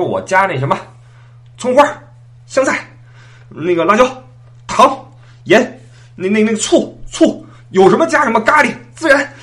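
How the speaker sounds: high, loud list of toppings; defiant ordering. Acted. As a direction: shout out each topping to outdo a rival. One-upping the shouted order. (不服气地高声报料)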